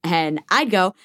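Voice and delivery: ordinary delivery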